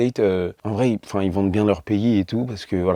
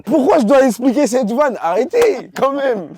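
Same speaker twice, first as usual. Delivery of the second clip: high-pitched comic protest, louder on the question; indignation jouée; 14 semitones higher, 4 dB louder, slower than usual. Pretend. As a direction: plead your case in an exaggerated squeal. S'indigne qu'on boude sa vanne. (indignation comique, voix haut perchée)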